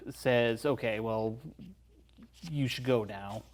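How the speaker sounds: usual speaking voice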